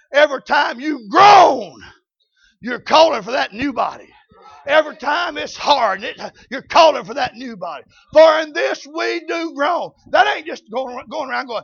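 loud preacher's cadence; preacher urging the church about groaning; full-force preaching. Acted.

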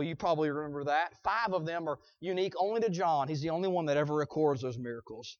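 ordinary delivery

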